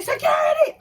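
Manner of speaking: high loud yell for help; shouting for security. Retold or imitated. Fan yelling for security at a drunk girl, loud and high. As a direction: shout to get the guards over. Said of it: "I am so loud".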